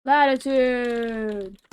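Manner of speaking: long, slow, loud stretched word; drawn-out exclamation. Real, not acted. Player reading a puzzle answer, stretched out loud. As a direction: stretch it out theatrically.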